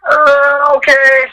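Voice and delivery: high, nervous okay; hesitant, pitched high. Retold or imitated. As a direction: runner nervously agreeing to the boss. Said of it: "I'm like".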